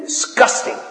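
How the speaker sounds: breathy whispered "Disgusting"; disgusted whisper. Retold or imitated. Preacher voices God's disgust, whispered. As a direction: hiss it out with revulsion. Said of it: "Sorry, I get a little riled up".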